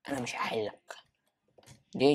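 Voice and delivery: normal talking voice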